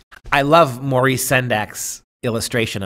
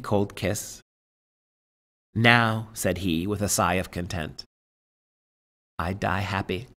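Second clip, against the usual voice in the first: story narration; 2 semitones lower than usual. Acted. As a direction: read the tale aloud, letting each image land. Reads the tale aloud.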